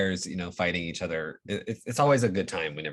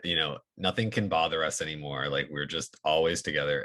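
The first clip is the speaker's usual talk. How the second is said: ordinary talk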